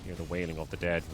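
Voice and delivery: zombie. Pretend. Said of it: "I sound like a zombie right now".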